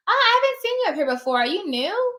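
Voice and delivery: high, quick and eager; friendly surprise. Shown as a demonstration. Notary asking a receptionist if she is new, eager and high. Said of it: "And then you can even say".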